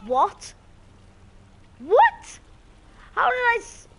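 loud, high-pitched disbelief; shocked outcry. Real, not acted. Gamer baffled at being killed, shocked high shout.

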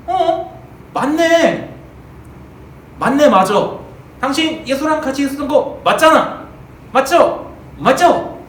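accusing servant. Acted. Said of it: "다른 요정이 나타나서".

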